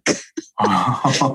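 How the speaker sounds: short amused chuckle; low chuckle. Real, not acted. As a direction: chuckle at the memory. Laughing while retelling neighbor.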